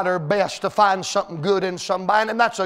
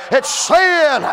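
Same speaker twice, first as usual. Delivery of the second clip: loud, higher preacher's shout, slowing down; full-force preaching; 6 semitones higher, 4 dB louder, slower than usual. Acted. Preacher warning the young people in the room, loud and higher. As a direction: warn them with everything you've got.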